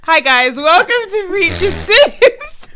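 loud, high, drawn-out welcome; big slow high-pitched podcast opener; put-on show greeting. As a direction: overdo the host's hello, stretching it out. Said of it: "I was trying to do something".

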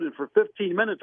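ordinary talk, nothing marked

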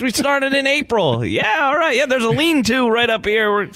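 high, folksy put-on voice of an old trail hiker; Wild Bill voice. Co-host mocks the hiker met on the trail, high folksy put-on. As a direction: talk like a friendly grizzled hiker on the trail. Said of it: "why do you sound like Wild Bill".

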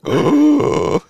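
loud, high oh of dismay; mock shocked gasp. Coworker finding out Felicia was Frank, loud mock shock.

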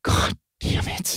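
hissed under the breath; angry whisper. Retold or imitated. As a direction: hiss it at him furiously. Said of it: "And he's like".

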